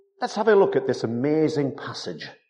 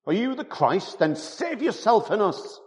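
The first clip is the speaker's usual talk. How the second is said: higher, jeering challenge; mocking; 11 semitones higher than usual. Preacher voicing the mocking criminal, jeering higher. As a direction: throw the taunt up at him, higher. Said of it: "one mocked him".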